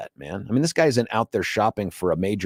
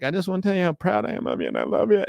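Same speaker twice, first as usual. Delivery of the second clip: high, quick, gushing dad voice; emotional; 9 semitones higher, faster than usual. Retold or imitated. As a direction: pour it out, choked up with pride. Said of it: "and I'm getting emotional".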